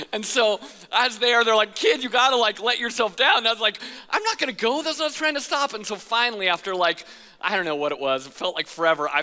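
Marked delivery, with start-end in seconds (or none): 1.8-3.4 high, loud call up the tube. Retold or imitated. Lifeguard calling up to the stuck kid, raised voice. 4.1-5.7 put-on high childlike protest; kid protesting. Stuck kid protesting to the lifeguard, high and childlike.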